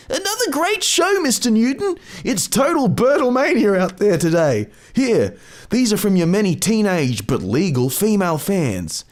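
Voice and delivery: high, light eager stagehand voice. Acted. Stagehand gushing to Bert backstage, high and eager. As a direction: chirp it like an excited young stagehand.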